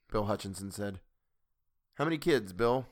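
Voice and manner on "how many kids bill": ordinary delivery, nothing marked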